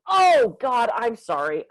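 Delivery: high, loud "oh" easing into a slow apology; imitated apologetic realization; mock sudden realization. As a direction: act out a client suddenly catching on. Client finally thinks he understands.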